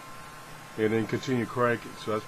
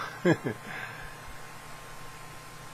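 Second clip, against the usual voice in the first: breathless laugh; 2 semitones higher, 8 dB quieter than usual. Real, not acted. Exhausted after cranking.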